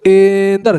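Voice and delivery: long held "e" opening "एंद्र"; drawn-out vowel. Shown as a demonstration. Teacher isolating the vowel after saying Surendra, drawn out. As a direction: stretch the first vowel of "एंद्र". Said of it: "बोल के देखिए".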